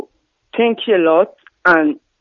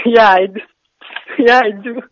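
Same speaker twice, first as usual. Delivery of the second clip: laughing. Real, not acted. Admits being born in France.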